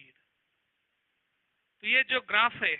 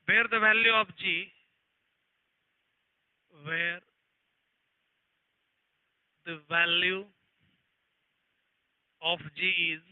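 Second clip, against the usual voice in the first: slow, word-by-word read-out of the question; slow dictation; 3 dB louder, much slower than usual. Acted. Teacher reading an exam question to the class, slow dictation. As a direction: read it out so students can copy it down.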